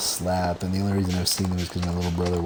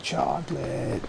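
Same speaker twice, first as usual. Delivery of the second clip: put-on higher, softer drawn-out old-lady cry; old lady impression; 5 semitones higher, 5 dB quieter, slower than usual. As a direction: imitate a cartoon old woman calling out. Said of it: "the old lady who was the one that was like".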